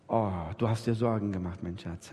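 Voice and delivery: tender comfort. Retold or imitated. Husband comforts his worried wife.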